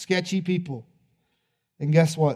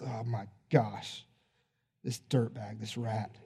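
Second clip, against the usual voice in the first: disgusted under breath; 7 semitones lower, 10 dB quieter, slower than usual. Acted. Homeowner sneers at Zacchaeus.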